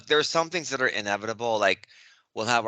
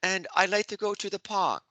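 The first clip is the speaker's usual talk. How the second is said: a higher, put-on English schoolboy accent; 8 semitones higher than usual. Host tests his English accent on his co-hosts, in a high schoolboy English accent. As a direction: try hard to sound English, in a childlike English accent. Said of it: "Does that sound English at all?".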